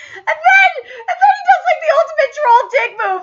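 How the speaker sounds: high, loud, laughing excitement; cracking up. Real, not acted. Fan telling the ultimate troll move, cracking up. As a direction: barely get it out through laughter.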